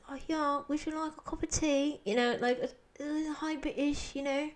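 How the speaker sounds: high-pitched British caricature; mock British accent. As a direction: put on a silly, high British voice. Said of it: "imitate a British accent".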